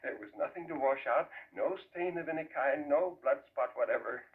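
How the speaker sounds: plain reading voice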